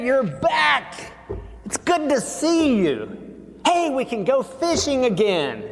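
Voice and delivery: high, bright-eyed welcome home; excited pretend brother, pitched high; cheerful greeting. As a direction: greet your brother with glee. Imagined happy brother's greeting.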